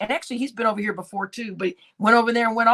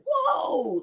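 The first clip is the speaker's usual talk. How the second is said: a much higher, drawn-out whoa; shocked whoa. Retold or imitated. Taken aback by the owner's accusation, a high stunned whoa. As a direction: recoil in disbelief on a high whoa. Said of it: "And I was like".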